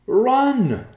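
high, very loud scream; screamed warning. A narrator's reading. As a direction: scream for them to flee. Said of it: "Evercloud screamed".

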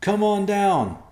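loud, high, drawn-out shout; high-pitched holler from below; yelling. Acted. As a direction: yell it slowly up to them. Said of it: "Evercloud yelled back".

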